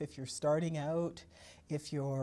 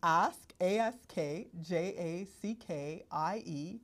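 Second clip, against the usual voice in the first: slow, letter-by-letter spelling; slow, deliberate dictation of an address; speaking slower; slower than usual. Shown as a demonstration. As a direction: spell it out slowly for someone writing it down. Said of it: "I'll repeat that and I'll speak slower".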